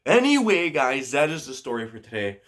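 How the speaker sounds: upbeat sign-off. Real, not acted. Vlogger wraps up the story for viewers, upbeat sign-off.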